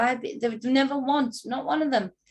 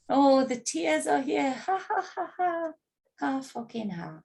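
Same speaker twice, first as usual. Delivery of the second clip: higher-pitched imitation of gleeful jeering; mock excited gloating; 5 semitones higher than usual. Woman mimicking the man who jeered at her crying, mock excited. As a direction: mimic his crowing, with a fake ha-ha laugh. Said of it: "He gets excited".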